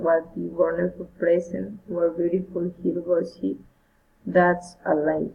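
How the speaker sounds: ordinary delivery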